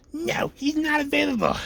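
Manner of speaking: high, drawn-out mock gatekeeper; hammed-up unhelpful receptionist; obstructive gatekeeper. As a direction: shoot the caller down in a slow, high voice. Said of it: "the gatekeeper shoots you down like that".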